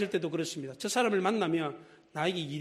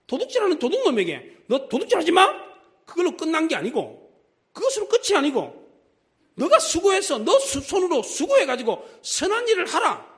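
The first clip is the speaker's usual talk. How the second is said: higher and louder sermon call; fervent preaching; 9 semitones higher, 6 dB louder than usual. Acted. Preacher urges the thief to do good, fervent. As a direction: drive the command home to the congregation.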